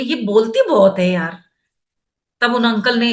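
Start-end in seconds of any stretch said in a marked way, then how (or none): 0.0-1.4 imitating mother. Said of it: "बोली".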